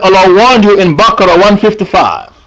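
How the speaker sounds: ordinary talk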